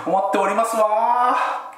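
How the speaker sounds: high, slow desu-wa lilt; prim haughty rich girl; ojousama voice. Pretend. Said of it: "お嬢様言葉だと".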